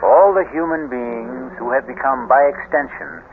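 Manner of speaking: steady announcer voice for credits and sponsors; host announcer read. A narrator's reading. Radio host introducing the drama and sponsors, announcer style. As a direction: present the show like a radio host.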